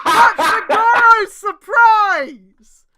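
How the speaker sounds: high, loud, slow scandalized shriek; hammed-up shriek. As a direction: drag it out in mock outrage. Reading the scandalous line aloud.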